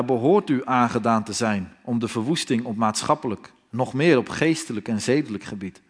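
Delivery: ordinary delivery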